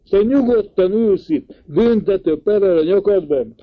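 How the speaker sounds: high, loud indignant outburst; uncle exclaims. A narrator's reading. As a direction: shout it at him in disbelief. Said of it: "kiáltott fel a bácsi, egyre hangosabban beszélt".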